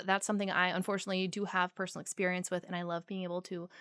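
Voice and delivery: ordinary talk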